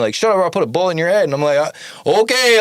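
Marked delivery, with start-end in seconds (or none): 0.2-1.2 fast, slightly raised mock yell; mild retold threat. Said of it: "the guy yelled at me". 1.6-2.6 high, loud sarcastic okay; mock unbothered; nonchalant okay. Not caring, mocking the gunman.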